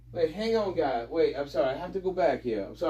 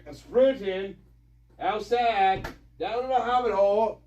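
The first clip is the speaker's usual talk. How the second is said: calling from off-mic; 5 semitones higher, 9 dB quieter than usual. Real, not acted. Calling back while heading outside.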